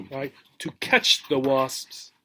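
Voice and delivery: usual speaking voice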